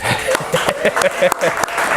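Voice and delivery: high unvoiced laugh. Real, not acted. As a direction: laugh it off brightly. Host laughs at audience.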